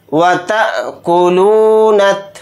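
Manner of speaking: वतअकुलूनत with a sharp catch on the अ; jerk on hamza. Shown as a demonstration. Teacher demonstrating the jerk on the hamza. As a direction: give the hamza a small jolt in वतअकुलूनत. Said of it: "इस तरह से झटका देंगे".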